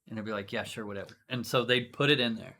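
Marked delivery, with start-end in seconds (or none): 0.5-1.2 bored shrug. Retold or imitated. Victim agrees lazily.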